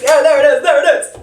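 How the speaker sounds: delighted reveal; excited. Real, not acted. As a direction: cheer the card as it appears. Card appears, excited.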